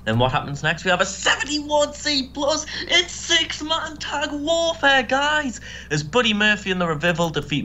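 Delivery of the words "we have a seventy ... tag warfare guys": very high, drawn-out match announcement; mock hype announcer. Host introducing the six-man tag, mock hype announcing. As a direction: call it out slowly in a high, hyped-up voice.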